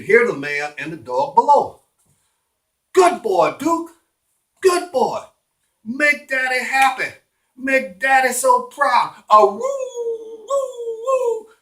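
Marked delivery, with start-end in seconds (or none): 2.9-9.1 high, cooing praise for a pet; pitched-up good-boy voice; doting dog owner. Acted. 9.3-11.5 very high, drawn-out howl; long pitched-up dog wail. Acted. Narrator voices the dog howling.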